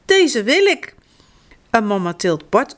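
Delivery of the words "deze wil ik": put-on high-pitched, insistent little boy; mildly indignant demand, pitched up. A narrator's reading. Bart insists on the sandals he chose, indignant. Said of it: "zegt Bart verontwaardigd".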